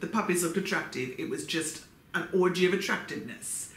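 ordinary talk

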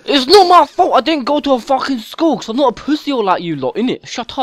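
exaggerated London chav accent, mouthy; whiny London chav accent, picking a fight; put-on London chav accent. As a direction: play a stroppy London chav kid on a game mic. Chav troll persona.

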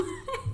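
squeaky high laugh; real high giggle. Real, not acted. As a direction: giggle at her own joke. Laughs at evil stepmom.

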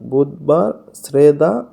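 ordinary talk

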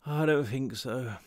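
slow, tired slur; words dragging sluggishly with tiredness; slurred. A narrator's reading. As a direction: slur it drowsily, too tired to remember. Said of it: "I slurred".

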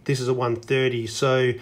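ordinary talk, nothing marked

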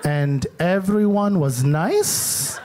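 slow, low, vague mom voice brushing it off; imitating mom. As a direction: mom dodging her son's questions, slow and evasive. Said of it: "She was like".

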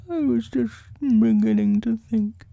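high, forlorn bear voice; small sorry bear, pitched high; slightly sniffly. A narrator's reading. Pooh worrying to Christopher Robin, high sniffly voice. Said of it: "sniffing slightly".

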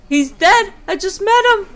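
distraught. Real, not acted. Reacting to father's death.

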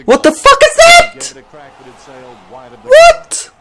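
shocked disbelief. Real, not acted. Gamer reacting to his player's blunder, disbelieving shriek. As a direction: shriek in outrage at what you just saw.

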